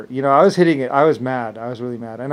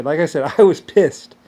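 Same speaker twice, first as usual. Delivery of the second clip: ordinary talk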